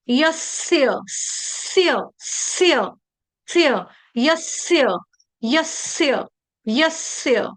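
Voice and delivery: यस्य as yas-sya, long hissing s; correct pronunciation drill. Shown as a demonstration. Teacher drilling the word, held-s pronunciation. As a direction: isolate स्य as one joined sound. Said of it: "तो शब्द क्या है?".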